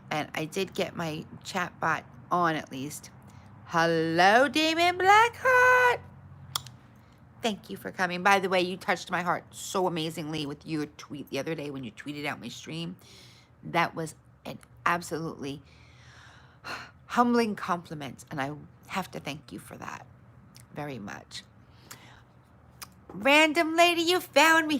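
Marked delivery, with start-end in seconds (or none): 3.7-6.0 stretched, much higher, louder hello; singsong greeting. Real, not acted. Streamer greeting a chat viewer, drawn-out singsong hello. 23.1-24.8 much higher and louder, delighted; relieved excited greeting. Real, not acted. Streamer thrilled a viewer found her stream, excited greeting.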